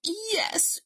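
hammed-up sick bear; pitiful coughing. A narrator's reading. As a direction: poorly bear voice with a feeble cough. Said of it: "Bear murmured, coughing pitifully".

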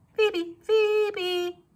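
high birdlike call; phoebe call. A narrator's reading. Narrator voices the phoebe's call, high and chirpy. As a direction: chirp the name like the bird.

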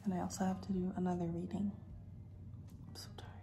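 soft whisper in a quiet room; library whisper. Real, not acted. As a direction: whisper so nobody nearby hears. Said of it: "Whispering 'cause I know people can hear me".

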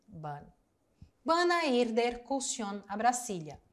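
normal talking voice

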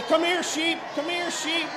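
high, loud call for the sheep; shepherd's shout. Shown as a demonstration. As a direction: holler for the flock across the field. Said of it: "Call, say".

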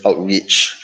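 ordinary talk

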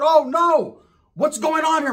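high startled voice; lightly voiced high startled figure; mock high voice. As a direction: squeal it like a caught diva. Disguised person reacts high.

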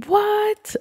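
a squeaky high disbelieving what; shocked what. Real, not acted. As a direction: player can't believe the uncle appeared, squeaky shock. Reacting to uncle's arrival.

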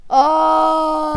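loud, high, long mock wail; play-acted cry of a thrown figure; hammed-up drawn-out scream; pretend wailing cry. Android thrown across the map.

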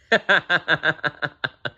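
high, long staccato laugh; hearty laugh. A narrator's reading. Bears laugh at the joke.

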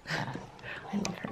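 slow, hushed whisper. Real, not acted. To viewers with the dog asleep on her lap, a slow whisper. Bleeker's just sleeping on my lap.

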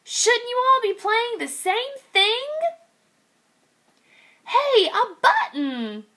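high puzzled piglet; little pig. Acted. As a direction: wonder aloud in a small voice. Piglet voices.

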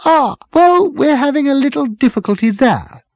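put-on high, loud, strident girl's voice. A narrator's reading. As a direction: cut in stridently, pitched right up. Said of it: "the girl with the strident voice".

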